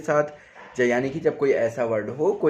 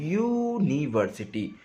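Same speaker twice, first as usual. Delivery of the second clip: University said slowly, opening on यू; slowed pronunciation; slower than usual. Shown as a demonstration. Teacher slowing the word to show its first sound. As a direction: stress the यू at the start of university. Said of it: "इसको समझिए".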